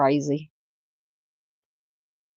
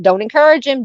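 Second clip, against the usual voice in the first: higher and louder, playful scolding; exclaimed scolding; 6 semitones higher, 4 dB louder, faster than usual. Real, not acted. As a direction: call it out to the chat, teasing. Host scolds chat jokingly.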